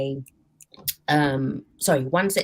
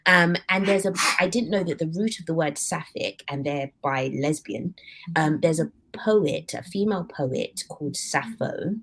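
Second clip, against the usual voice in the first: ordinary delivery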